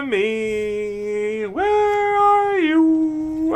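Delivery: slow, drawn-out high calling; sing-song calling out. Pretend. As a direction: call out like hide and seek. Calling out slowly for enemy.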